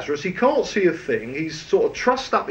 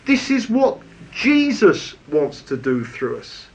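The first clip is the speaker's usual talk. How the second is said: usual speaking voice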